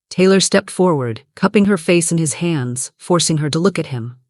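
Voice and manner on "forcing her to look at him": ordinary delivery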